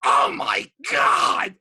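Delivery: slow, drawn-out shouted oh my God; mock shout. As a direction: bellow it out slowly in mock shock. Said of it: "and he goes".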